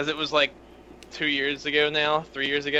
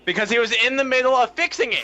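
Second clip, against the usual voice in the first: frustrated yell, much higher and louder; scream; 8 semitones higher, 6 dB louder than usual. Real, not acted. Friend yells while explaining the broken PS4. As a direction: shout it out in exasperation. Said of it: "You don't have to scream".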